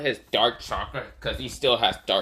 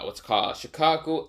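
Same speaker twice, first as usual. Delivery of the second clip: ordinary delivery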